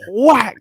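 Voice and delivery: slap sound. Pretend.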